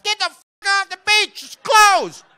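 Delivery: high, very loud shouted order; dog voiced as a furious high yell; snapping yell. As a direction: snap and scream at the people on the beach. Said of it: "she just finally snaps".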